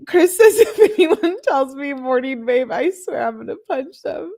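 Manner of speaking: high, loud reading through laughter; laughing. Real, not acted. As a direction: crack up while reading it out. Laughs reading a viewer's comment.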